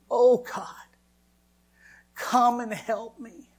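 high, loud, slow anguished wail; desperate slow prayer, rising to a near scream; crying out to God. Retold or imitated. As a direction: cry out in despair, voice climbing and breaking. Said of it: "screaming and crying".

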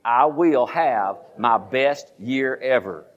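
very slow, each word spaced; drawn-out call-and-response vow; slow declaration. Shown as a demonstration. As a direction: lead the congregation word by word. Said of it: "say it with me".

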